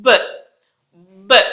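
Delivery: బ్ as a short burst from tightly pressed lips; brief voiced B with no word; isolated B. Shown as a demonstration. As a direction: pop out a lone బ్. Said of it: "lips చాలా గట్టిగా బిగిచ్చి".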